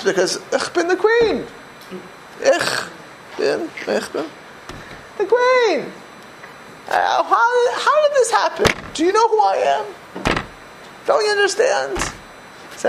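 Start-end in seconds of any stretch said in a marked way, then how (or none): 0.5-12.0 much higher, half-crying mock lament; hammed-up high whine of a self-styled royal; mock wounded queen. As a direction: wail it like a deposed queen. Mocks self-important "queen" distress.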